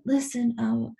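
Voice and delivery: high-pitched Southern secretary; Southern Texas accent. Retold or imitated. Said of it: "I'm trying to do the accent".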